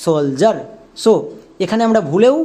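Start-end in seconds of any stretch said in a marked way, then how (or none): 0.0-0.6 সোলজার as sol-jaar, j in place of d; সোলজার in two syllables, sol-jaar; pronounced with j. Shown as a demonstration. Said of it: "ডি এর জন্য বর্গীয় জ হবে".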